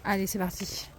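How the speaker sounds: murmure à peine soufflé; souffle-le pour toi-même en repartant; chuchoté. Real, not acted. Setting off again. (chuchote, sans voix)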